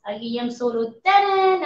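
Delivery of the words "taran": mock fanfare. Revealing earbuds to viewers.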